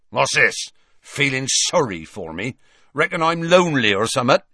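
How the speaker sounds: grumpy, rising challenge; grumpy Hagrid. A narrator's reading. Hagrid suspecting his visitors pity him, grumpy. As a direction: needle them with suspicion. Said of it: "said Hagrid grumpily".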